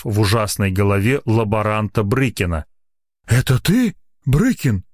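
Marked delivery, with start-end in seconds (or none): none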